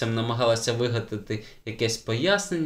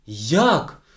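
short loud outraged question; indignant; 4 semitones higher, 6 dB louder than usual. A narrator's reading. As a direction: bristle at the answer. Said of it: "обурилася вчителька". (обурене «Як?»)

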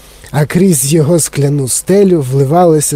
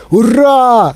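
high, drawn-out hurrah; excited cheer; 8 semitones higher, 3 dB louder than usual. A narrator's reading. Grandpa Joe cheers as the lift takes off, excited. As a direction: cheer high without really yelling. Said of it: "закричав дідунь Джо".

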